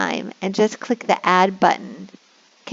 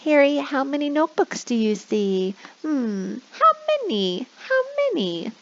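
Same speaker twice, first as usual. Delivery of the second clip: squeaky, much higher sing-song question; 6 semitones higher than usual. Shown as a demonstration. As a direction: squeak it playfully for a child. Squeaky prompting.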